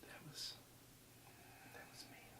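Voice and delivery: whispered apology, no voice; whispering. Real, not acted. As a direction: breathe the words, no voice at all. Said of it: "I'm sorry".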